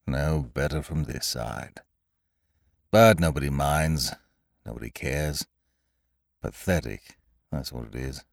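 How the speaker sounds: mope it out, dragging each syllable; gloomy donkey. A narrator's reading. Old grey donkey sighs at his reflection, low and gloomy. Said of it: "said Eeyore gloomily".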